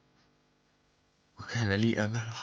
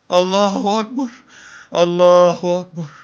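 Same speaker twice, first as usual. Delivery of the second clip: much higher, shouted takbir; battle cry; 6 semitones higher, 14 dB louder than usual. Acted. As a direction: roar it like an army on the field. Voicing the Muslims' battle cry.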